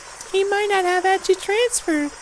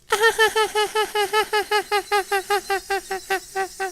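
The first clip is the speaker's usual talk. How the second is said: strange airy laugh; 3 dB quieter than usual. Acted. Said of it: "She apparently has a strange laugh today".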